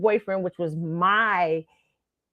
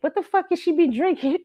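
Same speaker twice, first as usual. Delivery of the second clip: mock indignation; 10 semitones higher than usual. Retells protective guy friend's outburst.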